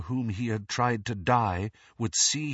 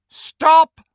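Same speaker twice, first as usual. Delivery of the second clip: commanding shout; 15 dB louder than usual. Acted. Voldemort commands the procession.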